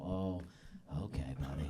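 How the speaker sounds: a hint of a grumpy city local; unimpressed local. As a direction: mutter it like a tired local. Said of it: "they'll go".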